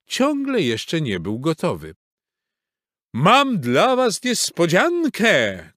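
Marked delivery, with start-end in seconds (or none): 3.1-5.7 high, loud, drawn-out call. A narrator's reading. Horse Maurycy to friends waiting at the gate, calling out. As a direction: shout it slowly from far away. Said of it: "krzyknął ze stajni".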